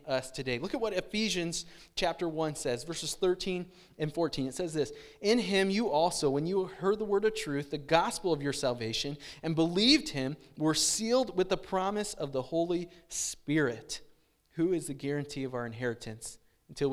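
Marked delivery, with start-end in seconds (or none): 5.2-16.9 loud, emphatic scripture reading; preaching scripture. Acted. Preacher reading Ephesians aloud, emphatic. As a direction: proclaim it to the congregation.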